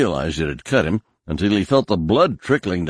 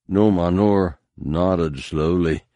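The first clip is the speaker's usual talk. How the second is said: ordinary delivery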